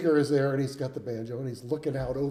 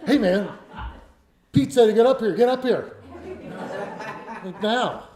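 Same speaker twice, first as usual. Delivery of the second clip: imitating a pushy, raised-voice order; mock hostile; 6 semitones higher, 3 dB louder than usual. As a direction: order them up here, higher and insistent. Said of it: "very hostile sloop singers".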